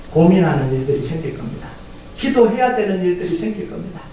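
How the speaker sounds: normal talking voice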